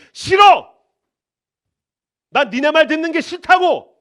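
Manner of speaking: loud high defiant yell; defiant shout. Acted. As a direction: throw off the crowd's demands. Defiant refusal voiced.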